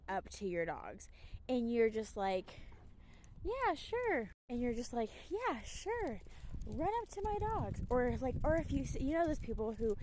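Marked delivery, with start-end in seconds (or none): 3.4-4.3 high, breezy mock "Yeah, sure"; airy don't-care shrug of a voice; mocking a careless dog owner. 5.3-7.8 higher, lightly mocking "Yeah, sure"; a light mock of a lazy owner; careless owner.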